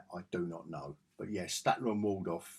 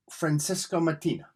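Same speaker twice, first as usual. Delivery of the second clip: put-on "Francesco Martina" said with a playful Italian accent, a touch higher and louder; 6 semitones higher, 5 dB louder than usual. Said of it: "Don't know if I said it in that accent".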